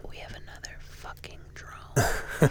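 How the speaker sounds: slow whispered ASMR line. Pretend. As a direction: whisper it slowly into the mic. Mock ASMR product countdown.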